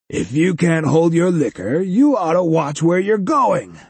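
mock a small guy, jovially; jolly giant. A narrator's reading. Cyborg giant voiced.

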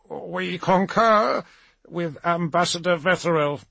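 high, halting, lightly mumbled concession; faltering official, drawing out the first words; reluctant mumble. A narrator's reading. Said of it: "he mumbled".

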